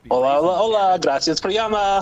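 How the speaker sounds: Spanish phone greeting. Retold or imitated. Worker greeting a caller as a prank, put-on Spanish. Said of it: "started answering the phone in Spanish".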